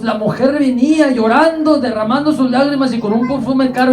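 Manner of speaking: high, sustained preacher's fervor; impassioned preaching. Real, not acted. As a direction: preach it out with rising passion. Preaches with raised voice. (predicación apasionada, con voz alta y aguda)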